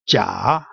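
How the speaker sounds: Jia dipping low, then rising; low scooped Jia with an upturned end; third tone. Shown as a demonstration. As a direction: take Jia down deep and bring it back up. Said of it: "these are four different tones".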